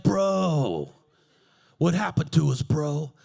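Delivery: deep, loud bro voice; stalker voice. Retold or imitated.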